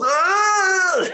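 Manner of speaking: high, loud ecstatic crowd squeal; mock the crowd's rapturous cry; imitated crowd squeal. Podcaster mimics crowd losing it at the promo, high ecstatic squeal. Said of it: "The crowd just goes".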